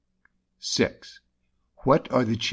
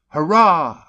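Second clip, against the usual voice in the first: loud high cheering hurrah; exultant hurrah; 7 semitones higher, 8 dB louder than usual. Shown as a demonstration. Teaching exultation, a loud hurrah. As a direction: cheer it out in triumph. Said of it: "exultation, as".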